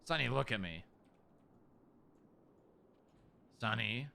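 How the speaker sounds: soft, low comfort for the dog; gently calm a scared pet; soothing. Real, not acted. Calming the upset dog.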